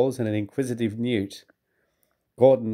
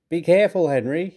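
warning; 6 semitones higher than usual. A narrator's reading. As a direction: warn him from above. Gordon warning Henry.

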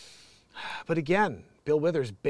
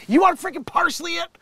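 mock angry; 14 semitones higher, 8 dB louder than usual.

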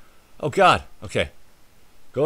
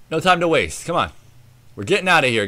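ordinary talk

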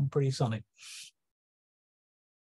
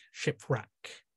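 shipwreck repeated much softer, falling; 7 dB quieter than usual. Acted. Neptune echoing the wreck, soft falling shipwreck. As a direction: drop shipwreck down to a murmur. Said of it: "in the voice of Neptune".

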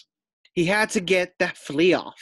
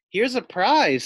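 higher, louder announcement; announcing; 6 semitones higher, 5 dB louder than usual. Acted. Teacher announces the prize to everyone, excited. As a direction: present it with a flourish.

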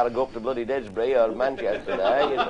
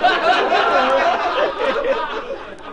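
high laugh; 13 semitones higher, 4 dB louder than usual. Real, not acted.

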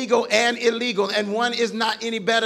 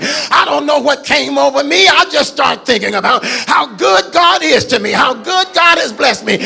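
shouted testimony climbing higher to a hallelujah; full-force preaching; 4 semitones higher, 8 dB louder than usual. Acted. Pastor testifying to the congregation, full-force preaching. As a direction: preach it until the church shouts back.

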